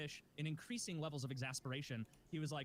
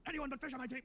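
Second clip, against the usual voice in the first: mock whiny teammate; 10 semitones higher, faster than usual. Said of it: "not wanting to be like".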